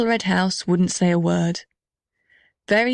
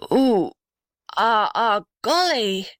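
pained groans with a higher cry; groaning in pain; 3 semitones higher, slower than usual. A narrator's reading. Old gum tree groans to the house, higher pained groans. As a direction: groan as if a sharp pain strikes. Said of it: "groaned the blue gum in a strange voice".

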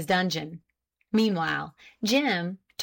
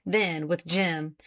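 ordinary delivery

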